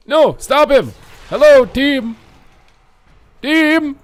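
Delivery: high, loud wail of protest; yelling at teammates. Real, not acted. Dying player to team that won't help, high outraged yelling. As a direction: scream it at the team that let you down.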